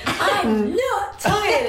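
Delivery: high drawn-out mock wail of I'm not tired; imitating her son's protest, stretched out; child's indignant wail, laughing. Mother imitating son shouting back at her, high mock wail. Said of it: "he screams and he's crying".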